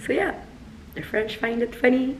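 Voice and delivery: ordinary delivery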